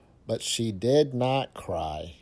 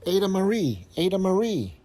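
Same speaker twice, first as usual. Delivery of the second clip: calling out; 8 semitones higher, faster than usual. Acted. Parents calling the child.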